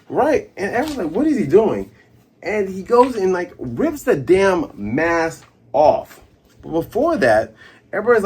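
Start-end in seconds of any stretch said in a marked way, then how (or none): 1.1-1.9 high, loud puzzled question; baffled exclamation. Retold or imitated. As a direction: exclaim it as a bewildered crowd. Acting out baffled onlookers.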